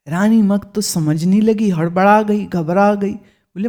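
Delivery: ordinary delivery, nothing marked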